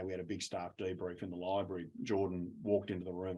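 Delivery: usual speaking voice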